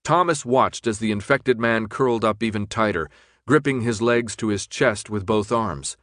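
plain reading voice

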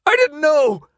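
very high, loud wailing protest; desperate wail. A narrator's reading. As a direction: wail it out pleadingly. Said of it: "the man wailed".

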